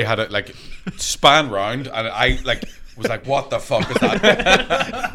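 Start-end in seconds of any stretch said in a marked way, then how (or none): 3.3-5.1 laughing disbelief. Retold or imitated. Shocked at man in toilets.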